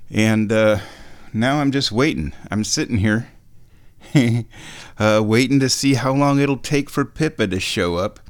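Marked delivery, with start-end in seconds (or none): none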